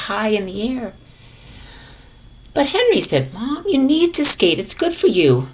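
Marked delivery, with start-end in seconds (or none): 2.5-3.0 high, coaxing mother's call; a mom urging her son; mom pleading. Acted. 3.7-5.5 mom urging. Acted.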